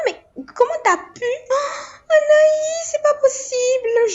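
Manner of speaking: high, crying wail of dismay; mother wailing over her daughter's piercing; anguished cry. Real, not acted. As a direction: wail it as if heartbroken.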